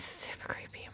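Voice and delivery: hushed whisper of fright. Real, not acted. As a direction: breathe it out, barely voiced. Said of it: "So creepy".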